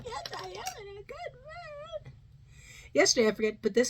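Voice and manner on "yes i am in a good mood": very high, soft squeak; silly falsetto good mood; squeaky high voice. Pretend. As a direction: squeak it like a cartoon.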